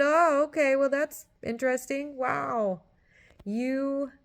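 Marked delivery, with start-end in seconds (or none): 0.0-2.8 higher, louder mock-impressed gush; mock impressed viewer. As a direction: parrot a viewer charmed by the movie. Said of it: "You said".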